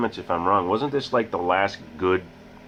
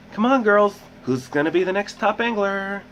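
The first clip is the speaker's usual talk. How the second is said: Tyra Banks impression; 13 semitones higher than usual. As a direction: hype the contestants like a TV host. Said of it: "Hosted by Tyra Banks".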